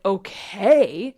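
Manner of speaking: loud, drawn-out okay, rising; voice of the emotion. Acted. Emotion answering you, loud and doubtful. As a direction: stretch okay into an unsure question. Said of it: "the emotion is like".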